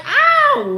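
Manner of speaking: put-on high, loud childish yelp of pain. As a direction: squeal it like a slapped kid. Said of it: "I'm like".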